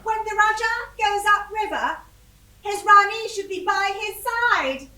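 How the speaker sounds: high, loud, imperious Rani; best Rani voice. Retold or imitated. Wife tells Rajah she is coming, high Rani voice. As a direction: proclaim it as a self-styled Rani. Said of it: "in my best Rani voice".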